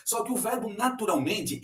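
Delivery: ordinary delivery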